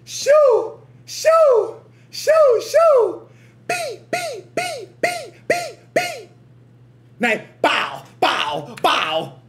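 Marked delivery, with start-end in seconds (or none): 0.0-3.3 high hype shouts. Real, not acted. Hyped reaction to the beat. 3.7-6.3 imitated beeps.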